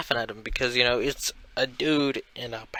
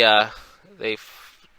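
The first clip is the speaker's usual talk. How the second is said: usual speaking voice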